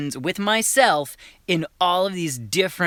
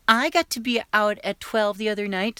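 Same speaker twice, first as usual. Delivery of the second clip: little sister's voice, pitched up; higher little sister voice; 6 semitones higher than usual. Acted. Little sister chiming in at dinner, in a higher voice. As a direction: chime in like a little girl. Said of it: "So you do, like, a higher voice".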